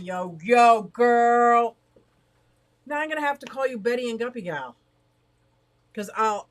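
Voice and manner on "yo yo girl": put-on loud hyped "yo, yo, girl"; playful shout. Streamer loudly hypes up a viewer with a new name. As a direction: call out to her like a homegirl.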